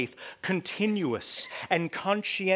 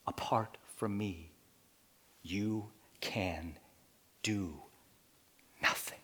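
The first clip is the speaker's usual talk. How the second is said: quiet; 8 semitones lower, 9 dB quieter than usual. Real, not acted. Quiet, slow scripture line.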